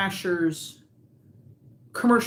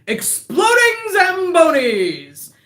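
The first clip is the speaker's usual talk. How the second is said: loud, high, slow shouted promo; booming announcer shout; 11 semitones higher, 9 dB louder, slower than usual. Retold or imitated. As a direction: belt it like a hype ad. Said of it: "And then he goes".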